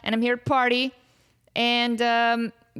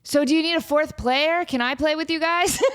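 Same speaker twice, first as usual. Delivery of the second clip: mock eager dude; 6 semitones higher than usual. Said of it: "the dude's like".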